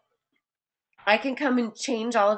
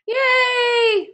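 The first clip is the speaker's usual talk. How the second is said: high, loud happy yay; excited cheer; 12 semitones higher, 6 dB louder than usual. Real, not acted. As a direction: cheer the correct answer. Student got the right answer.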